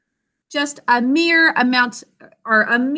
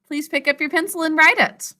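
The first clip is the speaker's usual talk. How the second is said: normal talking voice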